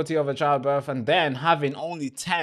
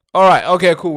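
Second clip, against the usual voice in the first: louder, higher, sudden relief; relieved burst; 6 semitones higher, 12 dB louder than usual. Real, not acted. Streamer blurting out relief when the stream returns. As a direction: blurt out relief as it works again. Stream comes back after freezing.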